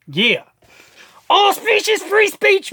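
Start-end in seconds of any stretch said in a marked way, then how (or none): 1.3-2.7 high shouted slogan. Real, not acted. Daring critics, shouting the slogan high. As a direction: pitch way up, shout it.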